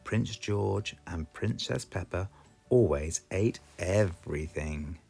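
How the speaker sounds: plain reading voice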